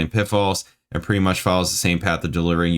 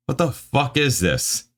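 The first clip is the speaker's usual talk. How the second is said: slow, high, exasperated question; mad disbelief; 6 semitones higher than usual. Real, not acted. Reader baffled by the review's wording, mad. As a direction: drag it out in disbelief, pitched up. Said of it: "this makes me mad".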